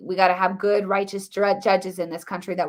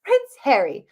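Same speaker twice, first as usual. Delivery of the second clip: mock British accent, higher and louder; Prince Harry; 5 semitones higher, 5 dB louder than usual. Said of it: "I played Harry".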